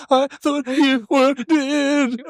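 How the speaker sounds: very high, drawn-out whine; hammed-up tearful panic; mock distraught wail. Teasing a friend about her worried message, mock wailing.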